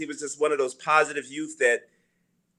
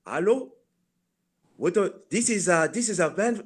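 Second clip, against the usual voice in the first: put-on African accent, raised pitch; fake African accent; 4 semitones higher than usual. Prank-calling an old friend after thirty years, fake African accent. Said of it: "do my fake African voice".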